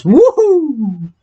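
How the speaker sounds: high, excited woohoo; cheer. Real, not acted. Cheering to viewers about subscribers, excited whoop. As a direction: cheer it like a small victory.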